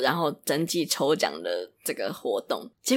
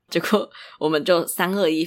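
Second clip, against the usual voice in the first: ordinary talk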